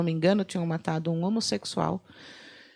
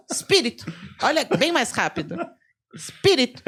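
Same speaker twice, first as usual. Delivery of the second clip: mock warning call; 6 semitones higher than usual. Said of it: "era só falar assim".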